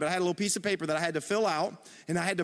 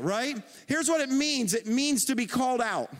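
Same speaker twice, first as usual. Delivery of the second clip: usual speaking voice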